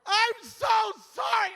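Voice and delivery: high, loud hammed-up wail; mortified apology. Driver apologizing to church member, mortified wail. Acted apology after being caught.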